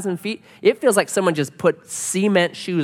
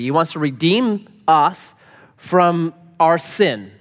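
usual speaking voice

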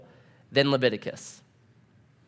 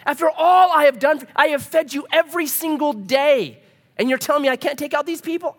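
high, loud, incredulous outburst; hammed-up indignant disbelief; exasperated God; 10 semitones higher, 5 dB louder than usual. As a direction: rant in mock outrage, pitch way up. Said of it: "And so God's like".

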